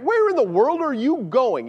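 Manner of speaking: baffled apostle's protest; exasperated question. Acted. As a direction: demand an answer in exasperation. Exasperated apostle questioning Jesus' answer.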